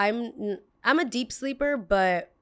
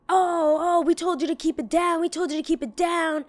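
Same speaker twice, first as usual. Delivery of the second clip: high, mocking whine of the grumpy neighbor; 9 semitones higher than usual. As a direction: pitch it way up to mock the angry neighbor. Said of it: "you weirdo, like".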